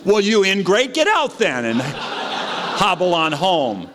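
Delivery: high mock-indignant scolding; indignant retort. Drunk driver scolds the passenger with hammed-up indignation. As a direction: huff at the ungrateful passenger.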